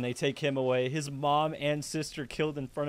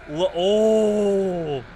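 loud, raised, drawn-out moan; pitying cry; 5 semitones higher, 9 dB louder than usual. Real, not acted. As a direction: let out a long moan of sympathy. Reacting to heart-wrenching crying.

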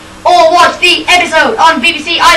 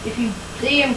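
usual speaking voice

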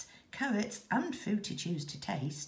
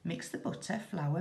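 ordinary delivery, nothing marked

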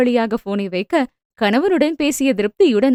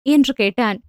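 ordinary delivery, nothing marked

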